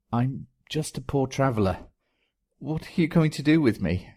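high, frightened pleading whimper. Acted. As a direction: beg like a terrified traveller.

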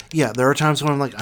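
ordinary delivery

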